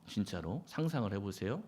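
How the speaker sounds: ordinary talk, nothing marked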